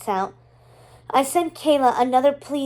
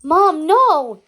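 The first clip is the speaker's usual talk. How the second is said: loud desperate yell, pitched up; 4 semitones higher, 7 dB louder than usual. Acted. Beatrice begging her mother to stop, loud yell. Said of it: "Beatrice yells".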